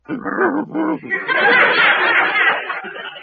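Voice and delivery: high, hooting cartoon guffaw; a dopey high-pitched laugh; goofy hyuck laugh; silly goofy laugh. Laughs at own pun.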